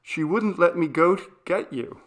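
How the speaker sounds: put-on high, loud little girl's plea; a scared child explaining; child's voice. A narrator's reading. Daughter telling mom why she stayed, high pleading. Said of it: "frightened child explains".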